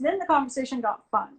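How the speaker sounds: ordinary delivery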